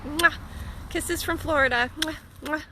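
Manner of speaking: playful kiss sounds; blown kisses. Real, not acted. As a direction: blow kisses to the camera. Blowing kisses goodbye.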